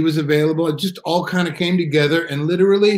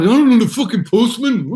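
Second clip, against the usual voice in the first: put-on a gruff Birmingham accent, raised and louder; 8 semitones higher, 5 dB louder than usual. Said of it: "That's a good Birmingham accent right there".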